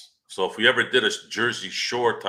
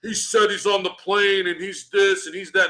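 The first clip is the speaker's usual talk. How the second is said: mock fan; 9 semitones higher than usual. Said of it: "I'm like, I'm like".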